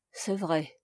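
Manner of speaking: low, soft murmur. A narrator's reading. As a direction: murmur it under your breath. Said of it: "murmura Toussaint".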